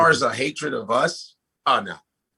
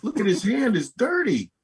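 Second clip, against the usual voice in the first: ventriloquist puppet voice; 10 semitones higher than usual. Retold or imitated. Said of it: "and you go".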